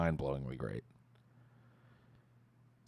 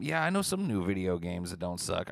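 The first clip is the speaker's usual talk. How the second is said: ordinary talk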